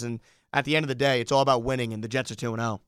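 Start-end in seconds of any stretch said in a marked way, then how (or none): none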